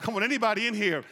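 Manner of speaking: usual speaking voice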